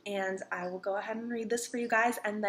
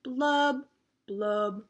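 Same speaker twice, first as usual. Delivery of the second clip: sinking blubs; much slower than usual. Acted. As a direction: let each blub sink like a bubble going down.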